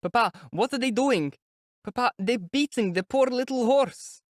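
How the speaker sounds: put-on high, pleading child's voice; upset child. A narrator's reading. A boy begs his father about the beaten horse, high pleading child. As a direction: beg your father to look.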